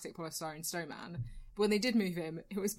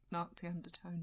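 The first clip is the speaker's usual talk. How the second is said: whisper; 3 semitones lower, 8 dB quieter than usual. Real, not acted. Whispered aside.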